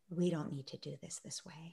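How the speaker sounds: unvoiced inner whisper; whispered thought. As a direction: think it to yourself in a whisper.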